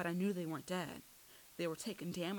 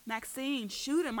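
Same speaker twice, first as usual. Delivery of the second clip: high, loud command; raised voice, urgently ordering; urgent yell; 7 semitones higher, 6 dB louder, slower than usual. A narrator's reading. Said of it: "I yelled".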